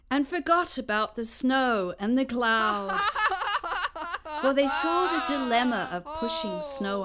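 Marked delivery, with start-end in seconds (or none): 3.0-4.4 high bright laughing burst; joyful laugh. Acted. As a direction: laugh with delight. People laughing in story.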